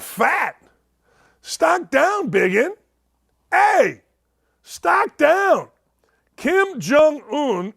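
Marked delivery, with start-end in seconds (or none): 1.4-7.7 mock shout. Mocking Kim Jong-un's weight.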